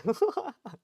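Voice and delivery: high-pitched burst of laughter; real high giggle. Real, not acted. Breaks up over forbidden knowledge.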